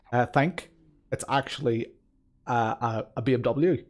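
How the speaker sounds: put-on halting, lilting amateur Belfast accent. Streamer introducing himself and his car, Belfast accent. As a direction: put on a wobbly Belfast accent, searching for words. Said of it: "I think my Belfast accent is actually".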